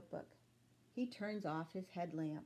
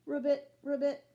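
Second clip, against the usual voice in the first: louder croaking ribbits; frog croak; 5 semitones higher, 8 dB louder, faster than usual. Acted. Frogs croaking outside in the night, mock croak. Frog sounds Bean hears.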